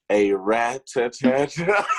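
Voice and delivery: bursts out laughing on the end. Real, not acted. Laughs out the ending.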